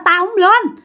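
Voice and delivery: high, stubborn shouted refusal; high-pitched defiant refusal; defiant yelling. Retold or imitated. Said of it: "la quá trời".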